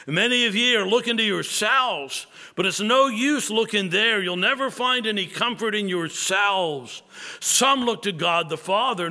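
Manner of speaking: a plain man pleading with a snowbound chapel; passionate lay preacher. Retold or imitated. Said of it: "he said in his broad Essex".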